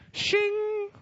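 high metallic shing of a blade; sword being drawn. Retold or imitated. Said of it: "take out your sword out of the sheath".

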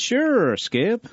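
high-pitched put-on American accent; Australian hamming up an American accent. Shown as a demonstration. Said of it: "Can you do an American accent".

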